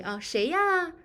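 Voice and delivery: gentle, lilting 谁呀; polite and soft. Shown as a demonstration. Teacher shows polite answer to a daytime knock, soft. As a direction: ask 谁呀 kindly to a daytime visitor. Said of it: "be polite and soft the tone".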